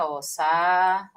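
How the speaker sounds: "sa" drawn out slowly as its own syllable; slow syllable demo. Shown as a demonstration. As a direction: stretch "sa" to show the split. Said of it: "vamos fazer a separaçãozinha".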